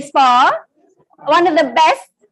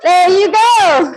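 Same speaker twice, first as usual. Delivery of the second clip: ordinary talk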